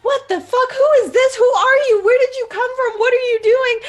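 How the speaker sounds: shrill-free but frantic barrage of panic; panicked. Acted. Zhao panicking at the stranger, high frantic. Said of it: "panic, like".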